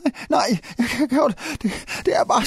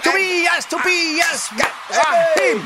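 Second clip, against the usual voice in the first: calling out; 3 semitones higher, slower than usual. Real, not acted. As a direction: shout the idol's name in bursts. Chanting artist's name before concert. (begejstret fan-råb)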